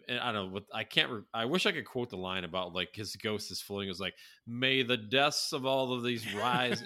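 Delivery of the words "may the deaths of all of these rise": put-on slow, drawn-out Southern accent, ghostly. To his cohost, quoting the ghost in slow Southern accent. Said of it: "he's got that Southern accent".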